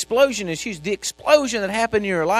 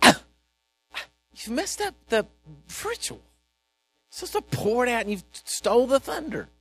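slow, indignant priest complaining; ticked off; 3 semitones higher, slower than usual. Acted. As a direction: scold Jesus for ruining the ritual. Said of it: "the priest was so ticked".

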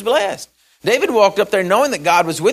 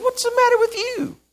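high, scoffing soldiers. Acted. Soldiers scoff at young David, high and scornful. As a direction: toss it up high in mock disbelief. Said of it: "everybody looked at him and said".

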